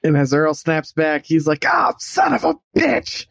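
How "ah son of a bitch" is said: high, drawn-out acted outcry; startled cursing. Earl snapping back from a vision, high drawn-out curse.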